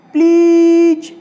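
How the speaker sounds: प्लीज with 'ee' held very long, high and loud; long ई sound. Shown as a demonstration. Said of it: "यहाँ पर भी आपकी ई की". (प्लीज में बहुत लंबी, ऊँची ई)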